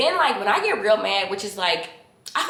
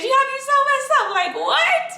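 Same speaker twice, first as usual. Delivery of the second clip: laughing; 3 dB louder than usual. Real, not acted.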